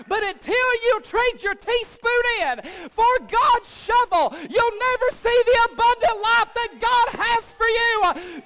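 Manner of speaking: loud, high, driving revival shout; full-force preaching. Real, not acted. Pastor urging church to trade teaspoon for shovel, full-force preaching. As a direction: preach it out, building to a ringing hallelujah.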